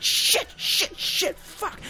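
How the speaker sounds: hushed panicked whisper of swearing; frantic whispered swearing as a car piles up; whispered cursing. Retold or imitated. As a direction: whisper the curses under your breath, high and tight. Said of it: "Riggs is like".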